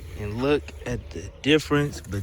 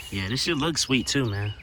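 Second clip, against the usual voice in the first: ordinary talk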